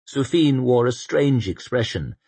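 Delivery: ordinary delivery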